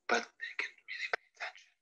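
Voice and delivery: fast, whispered. Shown as a demonstration. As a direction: drop to a hushed whisper.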